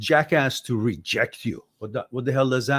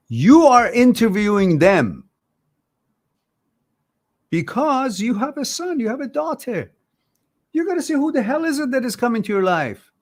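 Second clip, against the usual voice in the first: much higher and louder, heated; fired-up lecturing at a single parent; passionate rant; 10 semitones higher, 4 dB louder than usual. Real, not acted. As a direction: push hard, worked up for the listener. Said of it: "I gotta ease on my passion".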